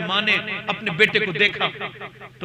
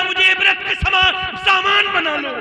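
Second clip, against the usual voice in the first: crying shout; 9 semitones higher, 3 dB louder than usual. Retold or imitated. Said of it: "رو کے چیخ کے کہتا تھا".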